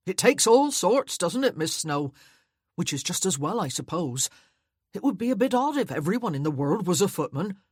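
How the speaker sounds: put-on loud, fast cheerful young footman. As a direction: chatter on brightly to the duchess. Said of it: "he said cheerfully".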